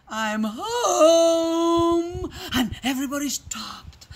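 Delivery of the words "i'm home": calling out. Acted. Father calls out on arriving.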